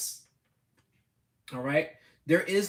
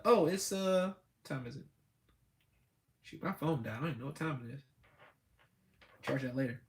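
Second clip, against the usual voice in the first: softer, muttering lazy self-talk; mumbling to himself; 5 semitones lower, 6 dB quieter than usual. Retold or imitated. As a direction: mutter like a drowsy procrastinator under your breath. Procrastinator talking to himself.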